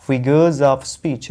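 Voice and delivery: figures of speech, very slow, as FI-guhz uhv speech; careful model pronunciation. Shown as a demonstration. Teacher models the whole term, slow FI-guhz av speech. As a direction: link "figures of speech" with z and v sounds. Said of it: "इसको बोलना है आव".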